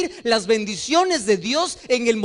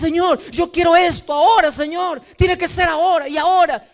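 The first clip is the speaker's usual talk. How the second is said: impatient demand; 6 semitones higher than usual. Retold or imitated. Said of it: "Y le dices".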